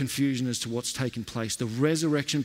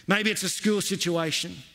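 ordinary delivery

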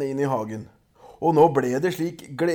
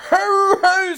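very high, loud howl of pain; troll screaming in pain; 13 semitones higher, 5 dB louder than usual. A narrator's reading. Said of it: "skrek trollet".

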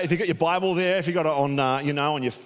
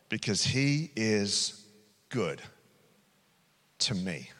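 scripture reading; 2 semitones lower than usual. Acted. Reads Psalm thirteen aloud.

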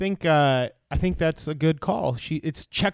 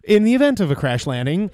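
in-flight announcement; 5 dB louder than usual. Pretend. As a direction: rattle off the safety briefing, louder. Mock in-flight announcement bit.

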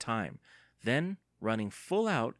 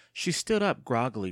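usual speaking voice

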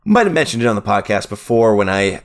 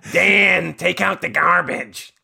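high, badly faked nagging wife; hammed-up bad sitcom-mom impression; Roseanne; 6 semitones higher, slower than usual. Roseanne ordering Dan about chores, high and drawn out. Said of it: "Hi, I'm Roseanne".

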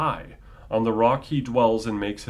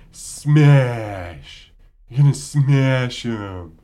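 much louder, forceful bark on smash; exploding God; 8 dB louder, much slower than usual. Retold or imitated. Lecturer voicing God exploding at Job, loud blast. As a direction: hurl the word like an angry deity. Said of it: "God basically explodes at Job".

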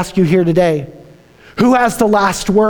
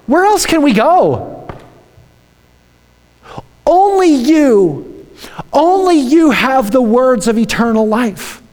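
pleading Peter; 5 semitones higher than usual. Retold or imitated. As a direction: beg like a disciple with nowhere else to turn. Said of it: "He says".

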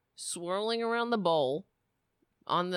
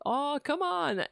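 drawn-out, higher mock groan; imitated protest; 6 semitones higher than usual. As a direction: imitate a viewer's slow, pleading objection. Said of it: "he's like".

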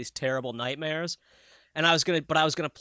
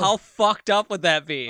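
excited; 4 semitones higher, 7 dB louder than usual. Real, not acted. Excited pitching a creepy idea.

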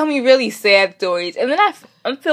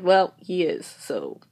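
ordinary talk

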